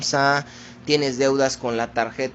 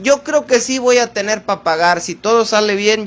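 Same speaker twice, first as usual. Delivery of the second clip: higher, lightly acted self-assurance; raised-pitch mock optimism; voiced optimistic borrower; 6 semitones higher, 4 dB louder than usual. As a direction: imitate a hopeful borrower talking themselves into it. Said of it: "que decimos".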